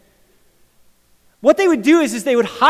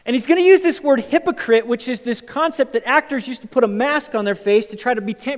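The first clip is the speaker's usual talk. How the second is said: ordinary talk, nothing marked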